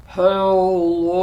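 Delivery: deep, low rumbling hello; a big bear-low hello. Shown as a demonstration. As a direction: drop it as low as you can. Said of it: "as low as we can".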